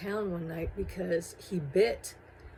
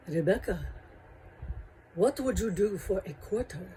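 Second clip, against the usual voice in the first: creepy vampire voice. Retold or imitated. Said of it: "And he talked like that".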